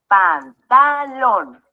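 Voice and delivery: "Pantalón" muy lento, sílaba por sílaba; separado en sílabas. Shown as a demonstration. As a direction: divide "Pantalón" en pan, ta, lón, alargando cada una. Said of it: "Vamos a dividirlo en sílabas". ("Pantalón" silabeado despacio)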